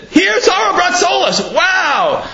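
high-pitched, mock-amazed proclamation; grand announcement. The Torah announcing Sarah's deed, mock-amazed fanfare. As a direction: proclaim it like big news. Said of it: "Why did the Torah announce it?".

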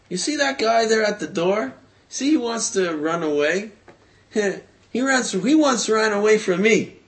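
high, loud storyteller's saleslady voice; pointed remark. Retold or imitated. Saleslady loudly points out the man at the door, pointed and high.

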